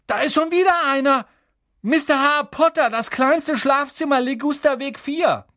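high, loud excited call; boy calling out. A narrator's reading. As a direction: shout it down the hall like a kid. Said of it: "Dann rief er".

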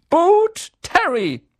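high, loud call across the hall; calling out name. A narrator's reading. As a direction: announce the pupil to the whole room. Calls out names. (Professorin ruft laut den Namen auf)

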